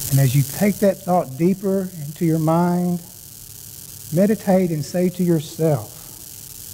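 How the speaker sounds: calm, slow, soft prayer voice; guided meditation. Acted. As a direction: guide them gently into stillness. Leads the opening prayer meditation.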